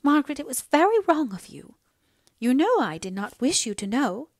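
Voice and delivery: loud, high-pitched scolding; displeased mother. A narrator's reading. Mother scolding daughter for learning the truth, displeased. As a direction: reproach her with emphatic swoops. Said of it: "became more displeased".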